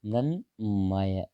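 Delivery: Mrunmay stretched very slowly, mrin-may; spelling demonstration. Shown as a demonstration. Teacher writing out the word, very slow spelling demonstration. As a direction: draw out each sound of Mrunmay. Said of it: "देखो, मृण्मय कैसे लिखा था?".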